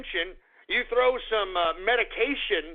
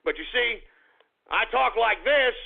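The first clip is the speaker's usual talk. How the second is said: usual speaking voice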